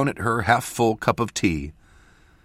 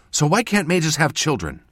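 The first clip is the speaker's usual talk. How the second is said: higher voice for the apprentice; a slightly huffy, raised question; lightly voiced apprentice; 9 semitones higher, 3 dB louder than usual. A narrator's reading. Apprentice asking mentor after fuming, lightly huffy.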